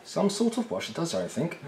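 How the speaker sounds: high, echoing the words in mock disbelief; mock surprise. Real, not acted. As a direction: repeat it as if scandalised. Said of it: "gasps You mean".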